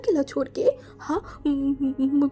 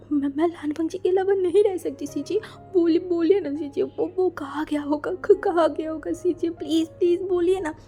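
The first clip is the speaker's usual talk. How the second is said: higher, broken sobbing plea; crying, stammering; 4 semitones higher than usual. Acted. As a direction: beg through tears, stuttering. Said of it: "wing ऐसे रोते जा रहा था". (रोते हुए हकलाकर विनती)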